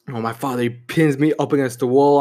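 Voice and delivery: usual speaking voice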